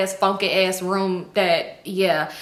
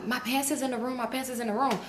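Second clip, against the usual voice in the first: sheepish mumble; 3 semitones higher, 8 dB quieter than usual. Retold or imitated. Embarrassed girl asks for pants.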